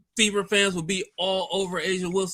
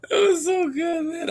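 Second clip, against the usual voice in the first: high squealing laugh through the words; can barely speak for laughing; cracking up at the replay; laughing hard; 12 semitones higher than usual. Real, not acted.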